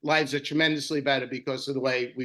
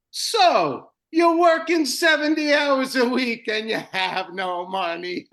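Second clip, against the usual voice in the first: much higher, slow, put-on seminar speaker voice; imitating Bob Spitz; 7 semitones higher, 4 dB louder, slower than usual. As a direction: ham up the guru opening the seminar, drawn out. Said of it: "And Bob Spitz starts out with".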